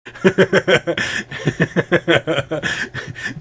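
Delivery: long high laugh; sustained high cackling laugh; laughter. Acted.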